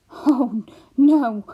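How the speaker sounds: loud horrified cry; exclaiming. A narrator's reading. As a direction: burst out in alarm. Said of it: "exclaimed Homily".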